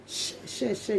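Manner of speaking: breathy whispered share share share. Real, not acted. Vlogger urging viewers to share, whispered. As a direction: whisper it like a secret. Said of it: "whispered plea to share".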